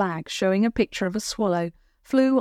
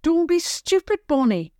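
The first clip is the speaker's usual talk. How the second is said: scolding brother; 10 semitones higher, 4 dB louder than usual. Acted. Brother scolding his sister.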